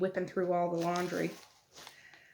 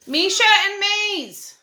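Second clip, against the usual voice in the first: long, high, loud holler of names; drawn-out parental shout; yelling; 13 semitones higher, 15 dB louder, slower than usual. Real, not acted. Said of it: "I'm sorry for yelling in your ears".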